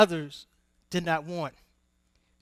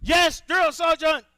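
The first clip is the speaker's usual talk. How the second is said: high, loud recruit reply; barked military recruit reply; 10 semitones higher, 6 dB louder than usual. Acted. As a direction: snap back at the sergeant. Recruit answering sergeant.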